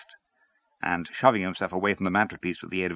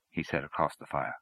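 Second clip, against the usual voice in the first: plain reading voice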